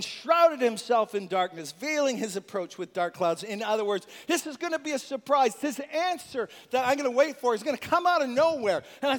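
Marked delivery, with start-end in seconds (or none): none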